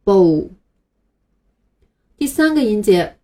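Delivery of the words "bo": bo said clearly as a model, b gliding into l; "bo" read slowly and distinctly for learners; teacher demo of syllable. Shown as a demonstration. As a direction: model "bo" for students to repeat. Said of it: "把这两个音连在一起读".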